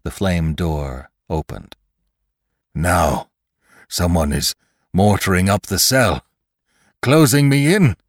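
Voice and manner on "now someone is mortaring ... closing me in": building from tense to a high, loud cry; dying man rising to a frightened cry; rising panic. Acted. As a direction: let fear climb to a shout. Lantry panicking as he's walled in.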